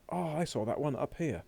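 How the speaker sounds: playful imitation of a pupil exclaiming; imitated pupil's exclamation. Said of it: "you'll be going".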